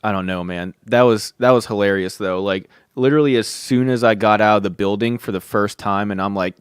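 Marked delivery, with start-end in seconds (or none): none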